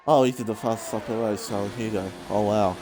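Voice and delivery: low, breathless afterthought; panting after a frantic call; out of breath. Real, not acted. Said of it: "I'm out of breath".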